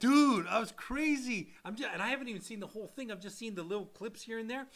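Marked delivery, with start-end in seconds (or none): 0.0-1.5 excited. Real, not acted. Excited about the clips.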